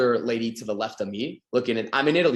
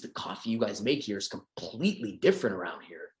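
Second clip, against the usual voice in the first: whisper; 6 semitones lower, 7 dB quieter than usual. Acted. Acting chat with barista.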